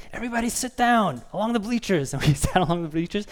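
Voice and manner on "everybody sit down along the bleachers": high-pitched mock coach yell; raised pitch, mock yell without real volume. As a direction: imitate a coach ordering the team around. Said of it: "he starts yelling at us".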